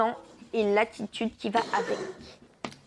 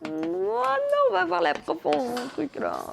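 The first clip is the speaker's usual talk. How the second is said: whiny classmate; 4 semitones higher than usual. Acted. Girl complaining at the back.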